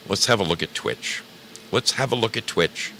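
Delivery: usual speaking voice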